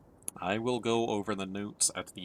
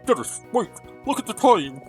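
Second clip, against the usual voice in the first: urgent call; 10 semitones higher, 7 dB louder than usual. Acted. Character urgently calls to companion.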